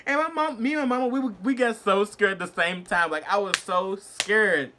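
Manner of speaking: high, loud, excited retelling; worked-up exclaiming. Real, not acted. Telling how mama and I got scared, high excited exclaiming. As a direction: exclaim it, stretching the last words.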